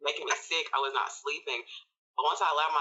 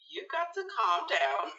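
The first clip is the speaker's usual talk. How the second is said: higher-pitched talking herself down; retold self-admonishment; 11 semitones higher than usual. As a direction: tell yourself to calm down, pitched up. Retold self-talk, telling herself to calm.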